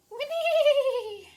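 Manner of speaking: high, loud whinnying neigh; whinny up high like a horse. Acted. Teacher voicing the horses for a child, high loud whinny. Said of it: "the horses are saying".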